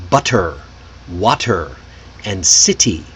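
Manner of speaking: butter, water, city with a crisp T. Shown as a demonstration. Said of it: "we don't use that crisp T sound".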